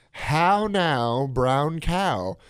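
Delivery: "How now, brown cow?" in a rounded posh British voice; elocution drill. Retold or imitated. Radio host imitates a British elocution drill for his guest. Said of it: "some Brit decided that was the way to teach elocution".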